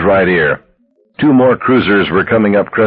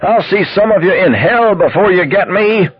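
high-pitched movie-gangster tirade; deliriously joyful yell; 11 semitones higher than usual. A narrator's reading. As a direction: taunt them high, drunk on defiance. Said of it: "delirious with joy".

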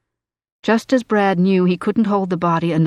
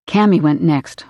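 usual speaking voice

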